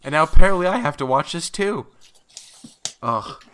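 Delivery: high, heated rant building to a yell; angry rant. Real, not acted. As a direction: let the annoyance boil over. Ranting at interviewer.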